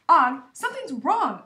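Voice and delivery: high, loud squeaking call. A narrator's reading. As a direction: squeak it out loudly. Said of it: "I squeaked loudly".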